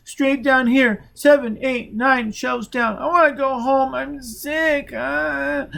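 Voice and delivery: put-on high, loud, tearful child's wail; high sobbing child voice; weeping child. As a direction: cry it out like a scared boy.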